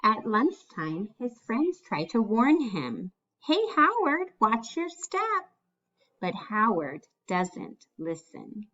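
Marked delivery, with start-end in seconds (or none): 3.4-5.5 put-on higher kid voice calling a warning; friend's warning. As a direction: chirp a quick warning to a friend. Friends warn at lunch.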